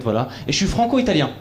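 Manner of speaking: ordinary talk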